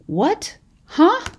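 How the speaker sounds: mock confusion. Mock confused student reaction.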